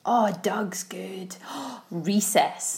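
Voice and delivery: excited gasping whisper. Real, not acted. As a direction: whisper it in delight.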